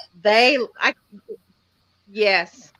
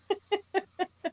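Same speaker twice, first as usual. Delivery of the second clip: high, very quiet chuckle; soft laugh; 8 semitones higher, 10 dB quieter than usual. Real, not acted. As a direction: giggle softly in sympathy. Sympathetic laugh about accents.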